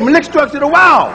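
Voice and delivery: Malik struck to the wall, as daddy said it; the family way of saying it; daddy's pronunciation. Retold or imitated. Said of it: "That's the way my daddy said it".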